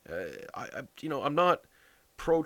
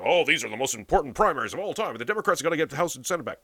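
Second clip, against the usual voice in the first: mock pundits; 3 semitones higher, 7 dB louder, faster than usual.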